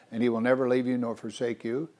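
ordinary talk

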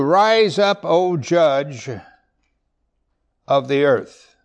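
scripture cried aloud; proclaiming. Acted. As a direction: cry out to the judge of the earth.

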